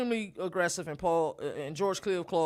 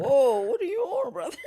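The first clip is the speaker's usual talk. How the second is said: teasing laugh; 13 semitones higher, 4 dB louder than usual. Real, not acted. Teasing about a girl.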